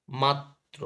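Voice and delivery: মাত্র split as mat-tro, t held; doubled ত. Shown as a demonstration. Teacher shows students doubling, মাত্র as mat-tro. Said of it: "ত এর সঙ্গে যুক্ত হয়েছে".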